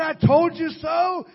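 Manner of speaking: slow, loud, shouted gloating; mock told-you-so. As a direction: shout it like a smug scold. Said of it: "He doesn't say".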